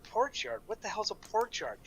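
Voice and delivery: high, baffled repeat; incredulous. Retold or imitated. Newcomer baffled by a Maine local, high and incredulous. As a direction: ask it like you've never heard the phrase. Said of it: "I'm like".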